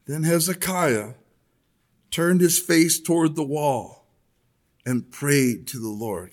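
measured, solemn Bible reading voice; scripture reading. Acted. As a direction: read the passage out to the church with weight. Reads scripture aloud to congregation.

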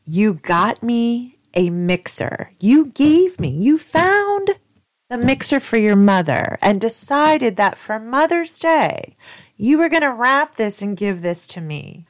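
loud, rising, indignant re-enactment; retold incredulous scolding, building from slow to loud; outraged disbelief. Retold confrontation over the insulting gift.

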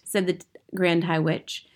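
usual speaking voice

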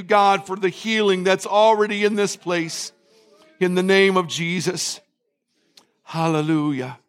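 steady murmured prayer with repeated "God"; fervent prayer. Real, not acted. Pastor leading the church in repentance prayer, earnest and steady. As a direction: pray aloud over the church, leading their repentance.

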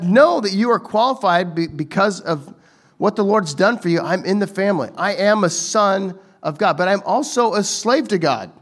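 ordinary delivery

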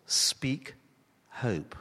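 breathy near-whisper on the two words; hushed motto. Shown as a demonstration. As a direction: lay the motto down softly, almost voiceless. Motto said softly for emphasis.

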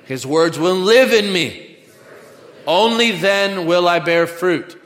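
loud, building call for repetition; preaching declaration. Real, not acted. Preacher has congregation repeat a pledge, building loud declaration. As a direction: lead the room, rising in force.

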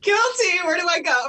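high-pitched, loud comic admission of guilt; mock confession. Mom confessing to the host she has lost it, mock guilty. Jokingly owns up to yelling.

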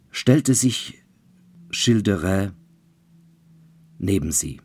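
ordinary delivery

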